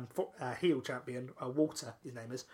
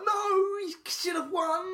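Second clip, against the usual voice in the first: high, drawn-out mock wail of protest; crowd protest; 13 semitones higher, slower than usual. Fan retelling the crowd's reaction to a missed count, protesting wail. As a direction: imitate fans crying out at an injustice.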